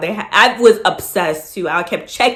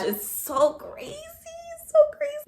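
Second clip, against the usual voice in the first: soft, drawn-out, rising high in wonder; amazed; 10 dB quieter, slower than usual. Real, not acted. Telling viewers about God's confirmation, high slow amazement.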